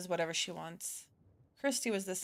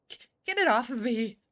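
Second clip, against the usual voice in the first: panicked plea; 3 semitones higher, 6 dB louder than usual. Acted. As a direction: beg in a panic to get it off. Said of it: "She's nearly in tears".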